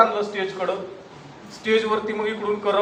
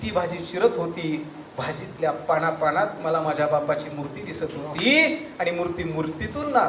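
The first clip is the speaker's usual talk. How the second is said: hushed grief; 2 semitones lower, 6 dB quieter than usual. Acted. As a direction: let the image sink in quietly. Poem's sad climax. (हळव्या मंद आवाजात)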